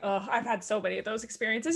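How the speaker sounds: usual speaking voice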